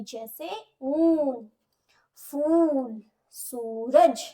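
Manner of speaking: ऊन, फूल, सूरज with the ऊ drawn out slowly; slow, stretched long-vowel examples; long oo sound. Shown as a demonstration. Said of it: "हमें लंबी ध्वनि सुनाई देगी".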